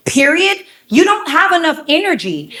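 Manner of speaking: usual speaking voice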